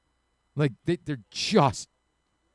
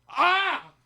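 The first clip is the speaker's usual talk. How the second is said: loud, very high squawk; mock squawk; 7 dB louder than usual. Host mimicking a Boston woman's sudden grating squawk. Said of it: "it would just come out of her".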